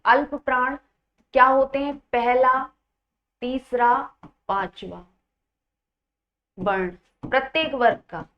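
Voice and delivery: ordinary talk, nothing marked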